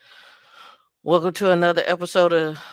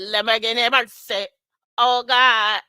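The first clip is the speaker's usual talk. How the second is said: raised, loud Jamaican dancehall call; 5 semitones higher, 5 dB louder than usual. Acted. Host reacting to a viewer's name, Jamaican dancehall hype. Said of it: "I be like".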